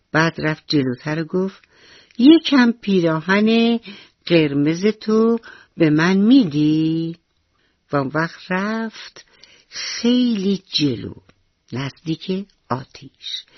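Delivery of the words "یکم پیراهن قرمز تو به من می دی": slow pleading. Acted. Mouse begs the fire sweetly.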